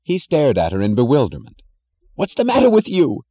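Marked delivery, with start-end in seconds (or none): 2.1-3.2 high, loud, bewildered gasp; gasping alarm. A narrator's reading. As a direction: gasp it out in shock. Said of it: "he gasped".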